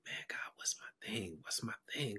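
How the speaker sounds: breathy whisper, searching; whispered prayer. Retold or imitated. Speaker asking God for his purpose, whispered. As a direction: whisper it to God late at night. Praying alone over the break.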